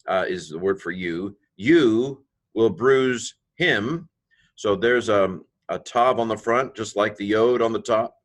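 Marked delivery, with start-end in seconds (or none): 1.6-4.1 "you will bruise him" stretched out, higher and louder; slow emphatic reading. Shown as a demonstration. Teacher stresses the emphatic "you" to the class. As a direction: lean hard on "you", slowly.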